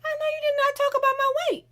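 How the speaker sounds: very high, loud mock indignation; hurt, offended feelings. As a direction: squeal it like you can't believe the insult. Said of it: "the feelings where you be like".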